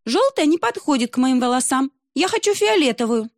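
put-on high petulant little girl; demanding. Русалка требует у отца фиолетовую медузу, высоко и капризно. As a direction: insist high and spoiled.

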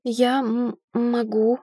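stammer, stretching the "м" before "могу"; hesitant stutter on "м-, могу". A narrator's reading. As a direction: get stuck on "м-", then push out "могу". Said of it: "заикаться начала".

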